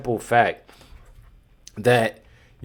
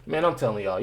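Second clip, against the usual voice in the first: normal talking voice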